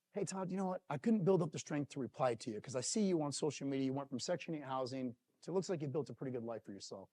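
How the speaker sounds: hushed, low retelling of a painful message; subdued, heavy-hearted voice; getting emotional. Retold or imitated. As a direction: read it softly, moved by the words. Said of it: "there I go again".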